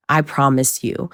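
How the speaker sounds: ordinary talk, nothing marked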